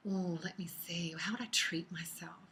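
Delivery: soft unvoiced whisper while thinking; whispering to herself. Real, not acted. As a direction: murmur it under your breath as you decide. Musing over her own treat.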